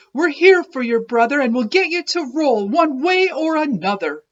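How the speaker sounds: high, loud rallying voice; determined cheer. Acted. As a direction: fire up your friend. Friends refusing to quit.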